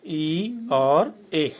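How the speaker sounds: very slow ई then ए, each vowel stretched; teacher sounding out ई and ए; sounds ई and ए. Shown as a demonstration. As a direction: draw out ई and ए slowly. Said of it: "ई का उच्चारण होता है".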